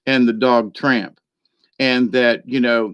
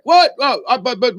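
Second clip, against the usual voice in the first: caught off guard; 11 semitones higher than usual. Retold or imitated. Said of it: "the guy was like".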